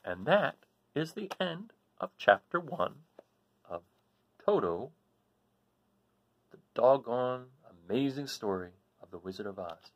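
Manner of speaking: lively read-aloud storytelling voice, rising with the storm; story narration. A narrator's reading. Reading to her children, a lively storybook narration. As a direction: tell it like a bedtime adventure for kids.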